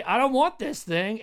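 high, emphatic mock rejection; defiant refusal. Sinner throwing the gift back at God, defiant. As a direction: act out an adamant refusal. Demonstrating how one rejects grace.

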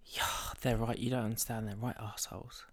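low, soft, half-whispered; real hushed grumble. Real, not acted. Host complains to co-host about neighbours, hushed grumble. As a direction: keep the complaint under your breath.

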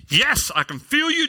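high, hammed-up cry of praise; imitated fervent prayer. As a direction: exult to the Lord like an overzealous believer. Retold fervent prayer while digging.

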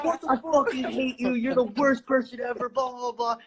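high, fed-up friend yelling; mock angry yell. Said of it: "is, like, screaming at me".

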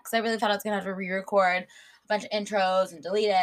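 ordinary talk, nothing marked

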